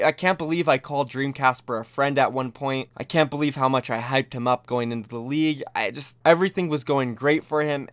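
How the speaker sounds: ordinary delivery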